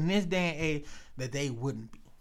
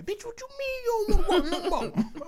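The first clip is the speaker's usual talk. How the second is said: pimp whining; 4 dB louder than usual. Pretend. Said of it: "I be crying".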